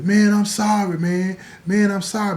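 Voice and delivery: high, loud begging cry; pleading apology. Retold or imitated. Jamaica beaten downstairs by the gang, panicked pleading. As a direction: beg them to stop.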